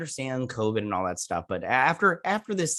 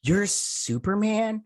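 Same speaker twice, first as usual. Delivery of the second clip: high, slow, stunned question; amazed disbelief; 10 semitones higher, much slower than usual. Retold or imitated. Lana stunned by Clark's reveal, slow high disbelief. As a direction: drag the question out in wonder. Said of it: "she's like".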